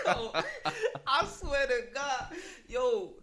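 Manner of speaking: laughing through the words. Real, not acted. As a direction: say it while cracking up. Laughing while swearing it's true.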